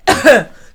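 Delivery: a put-on cough to act it out; 1 mock cough. Mock cough while joking to viewers. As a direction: cough for comic effect.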